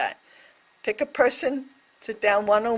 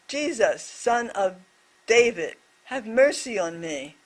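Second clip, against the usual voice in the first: normal talking voice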